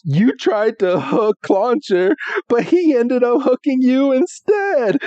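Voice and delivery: high mocking glee; Ash teasing. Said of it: "He's like".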